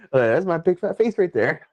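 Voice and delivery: high, playful mock excitement; excited recognition. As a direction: pipe it up high with glee.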